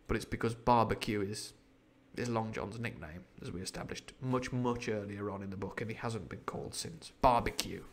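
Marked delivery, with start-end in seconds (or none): none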